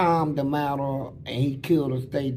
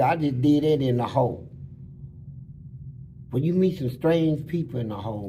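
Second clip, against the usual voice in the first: normal talking voice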